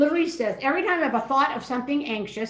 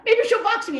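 high, louder hopeful thought; retold burst of eager anticipation; excited hope; 11 semitones higher, 6 dB louder than usual. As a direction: voice leaps up with sudden hope.

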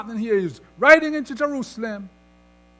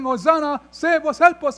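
loud, higher preacher's call; rejoicing crowd cry; 5 semitones higher, 6 dB louder than usual. Retold or imitated. Preacher voicing people greeting Jesus, rejoicing loudly. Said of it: "People are rejoicing".

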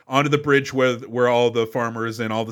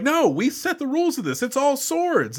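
high-pitched indignant whine; pitched-up outrage at a movie cheat; mock outraged protest; 11 semitones higher than usual. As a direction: squeal the protest like the rules were broken.

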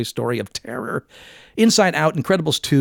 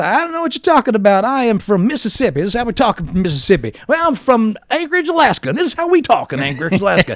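put-on Southern accent; 10 semitones higher, 4 dB louder, faster than usual. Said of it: "every part of America also has the Southern dialect".

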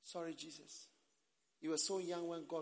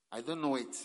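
loud denial at usual pitch; firm denial; 12 dB louder than usual. Acted. Voicing one who refuses the calling, firm loud denial. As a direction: deny it firmly, louder. Acted denial, first take.

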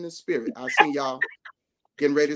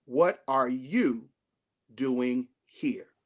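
a lightly voiced God, lower and slow; a hint of the still small voice, lower and slow; 7 semitones lower, much slower than usual. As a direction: ask it low and slow, as God. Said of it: "the still small voice asked the question".

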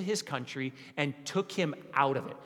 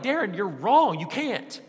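louder, higher, indignant correction; mock congregation objection; 4 semitones higher, 6 dB louder than usual. Congregation scolding pastor Darren, a raised indignant correction. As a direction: call out the preacher, scolding him. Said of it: "you would all be like".

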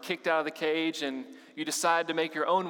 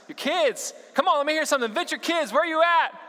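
much higher, louder rallying shout; 12 semitones higher, 6 dB louder than usual. Real, not acted. Pastor rallying the kids to answer back, shouting. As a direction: pump the room up and yell it out. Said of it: "that's kinda weak, guys".

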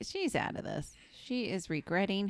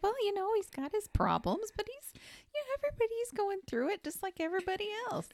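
high, wholesome excuse-making; very high, put-on gentle voice; mock mild-mannered host; faster than usual. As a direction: play the nice guy making allowances. Acting out mild-mannered kids' host.

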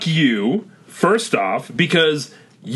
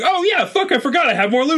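sudden realization; 12 semitones higher than usual. Retold or imitated. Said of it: "I can be like".

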